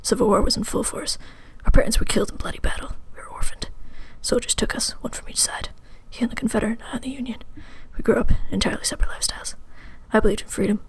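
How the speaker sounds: dramatic whisper. Pretend. Invented dramatic backstory, whispered.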